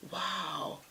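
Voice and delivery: breathless stunned whisper; whispered wow. Retold or imitated. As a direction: mouth it in quiet shock. Narrator reacting in shock.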